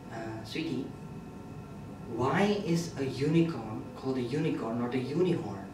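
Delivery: soft, low murmured question; quietly imitated girlfriend; girlfriend's dawn question. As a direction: ask a partner gently in bed. Retold girlfriend asking softly at dawn.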